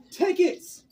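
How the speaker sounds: sudden high call of Tickets. Retold or imitated. Mom running at the nurse, shouting Tickets. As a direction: blurt Tickets at her like a yell. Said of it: "she didn't say anything else".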